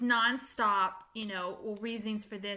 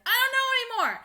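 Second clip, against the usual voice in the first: much higher and louder, throwing up hands, laughing at the end; overwhelmed outburst; 12 semitones higher, 7 dB louder than usual. Real, not acted. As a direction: let it burst out in frustrated laughter. Overwhelmed by theories, breaks laughing.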